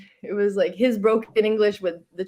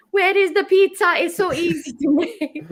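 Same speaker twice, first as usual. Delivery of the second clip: high, hammed-up Italian accent; mock Italian accent, exasperated; 11 semitones higher, 4 dB louder than usual. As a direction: play the pizza-demanding Italian. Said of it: "in his little Italian accent".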